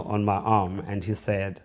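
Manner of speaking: ordinary talk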